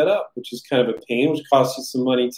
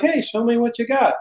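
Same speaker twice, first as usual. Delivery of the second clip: interested buyer; 8 semitones higher than usual. Retold or imitated. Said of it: "sometimes they're like".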